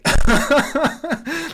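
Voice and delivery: high amused laugh; laughing at his own hot take; burst of laughter. Real, not acted. Streamer laughs at his own baby opinion, amused laugh.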